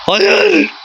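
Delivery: a high, despairing wail of no; anguished cry. Real, not acted. Gamer watching the opponent score, high despairing wail. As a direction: cry out the no in real anguish at the screen. Conceding a goal in the game.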